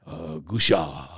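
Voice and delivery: low, slow Japanese grunt of a word; imitated Japanese father-in-law. Golfer recalling his father-in-law, low slow Japanese imitation. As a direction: drop deep and slow, imitating a Japanese elder. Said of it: "I think about my, my father-in-law".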